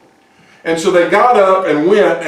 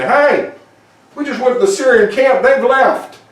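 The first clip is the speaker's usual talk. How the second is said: hollering up; 6 semitones higher than usual. Acted. Lepers holler up at the guard.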